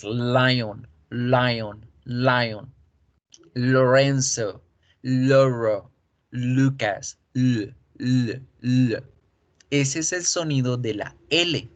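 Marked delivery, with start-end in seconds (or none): 0.0-9.0 lion said layon with a dark rounded l; rounded lips for every l; lion, Lorenzo, Loro with an English dark l. Shown as a demonstration. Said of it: "o en inglés".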